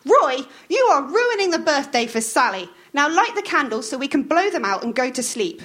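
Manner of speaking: bossy wife snapping at Roy; Maud scolding. A narrator's reading. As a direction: nag him to hurry up. Voicing Maud.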